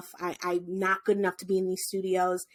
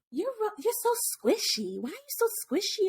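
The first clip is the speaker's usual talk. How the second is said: put-on much higher, fast kid voice; curious little boy; 10 semitones higher than usual. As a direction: ask it like a giggly kid. Boy squeezing her sides.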